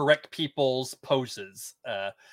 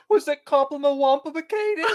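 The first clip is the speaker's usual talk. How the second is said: mocking; 9 semitones higher, 6 dB louder than usual. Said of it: "Valencia's even like".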